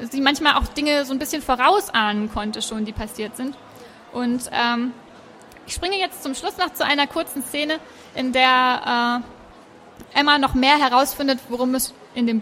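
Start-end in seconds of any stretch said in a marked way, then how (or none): none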